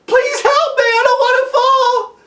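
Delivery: high, loud panicked cries for help; terrified yell. Acted. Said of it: "he began to yell".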